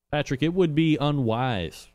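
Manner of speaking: ordinary delivery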